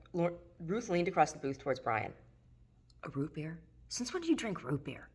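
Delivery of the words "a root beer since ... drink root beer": hushed disbelief. Acted. Leaning across, hushed question.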